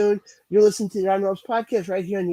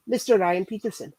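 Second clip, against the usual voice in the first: ordinary talk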